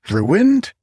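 high, loud rising ruined; can't believe he said that; incredulous. Acted. Oakley to the sulking King, delighted disbelief.

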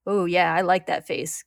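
vicious grin. A narrator's reading. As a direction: relish it through a nasty grin. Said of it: "says with a vicious grin".

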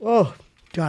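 stifling a yawn. Real, not acted. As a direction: talk through a yawn. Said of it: "I feel like I'm gonna yawn".

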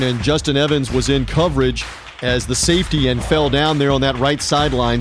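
ordinary delivery

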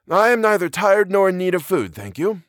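high-voiced professor, politely declining; understated, polite character voice; quiet refusal. A narrator's reading. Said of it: "the professor said quietly".

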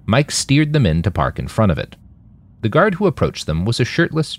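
ordinary delivery, nothing marked

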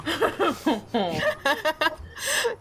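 high burst of laughter; a real, high giggle. Real, not acted. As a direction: crack up, going way up high. Laughing at the joke.

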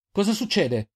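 high-pitched urgent yell; alarmed shout. A narrator's reading. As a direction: call out in alarm, pitch jumping up. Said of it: "urlò Reynal a Selin".

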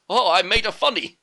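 high, loud and slow, pleased with himself; a proud old master enjoying his own pun; Splinter's pleased joke. Retold or imitated. Splinter to the turtles after his joke, delighted and slow.

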